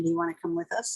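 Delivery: high, light casual invite; bright invitation. Retold or imitated. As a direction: ask breezily as if nothing happened. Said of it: "she said".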